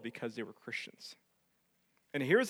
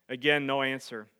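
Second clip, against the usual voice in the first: ordinary talk, nothing marked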